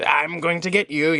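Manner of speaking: a playful Frieza menace, just a hint of it; retelling a fan photo, a mock Frieza voice. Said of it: "jumped into the Frieza voice".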